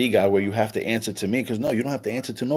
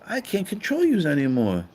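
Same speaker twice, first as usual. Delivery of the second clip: mock angry God, pitched higher; an irate God cursing the snake, pitched up; pissed off God; 7 semitones higher than usual. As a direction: ham up God losing control, raising his voice. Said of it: "he's like".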